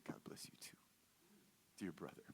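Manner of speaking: soft breathy whisper, almost no voice; whispered blessing. Retold or imitated. To the stranger who hugged him, a whispered blessing.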